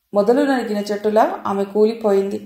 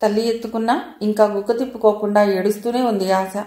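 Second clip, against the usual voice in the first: usual speaking voice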